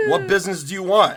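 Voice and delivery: loud, high, fast bark; loud gruff demand. Acted. Dwarf smith loudly demanding what the customers want. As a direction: demand it impatiently.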